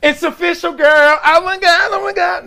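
put-on high-pitched gushing girl talk; mock gushing girlfriend. Husband teases his wife's reality show, high mock gushing. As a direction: play a gushing housewife friend.

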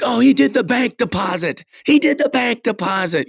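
high excited announcer call; mock announcer. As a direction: hype it up like a ring announcer. Announcer calling his wrestling move.

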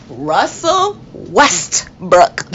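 Russell Westbrook said very slowly, each syllable spelled out; careful slow correction of the name. Shown as a demonstration. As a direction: draw out Westbrook deliberately, fixing a slip. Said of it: "Not Rest".

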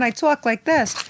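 high-pitched character accent; really high show voice. Shown as a demonstration. Actress showing her old TV voice, talking really high. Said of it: "normally I talk really high on the show".

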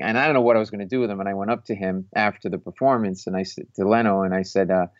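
ordinary talk

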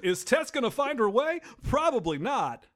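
spoof a TV cliffhanger, drawing out the answer; hammed-up announcer selling next week's episode; mock promo announcer. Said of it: "But if they're like".

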